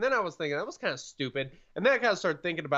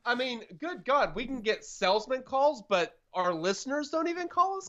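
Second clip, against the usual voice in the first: exasperated; 8 semitones higher than usual. Real, not acted. Exasperated rant at spam call.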